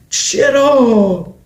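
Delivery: high, very slow "چرا"; drawn-out disbelief. Real, not acted. Disbelieving reaction.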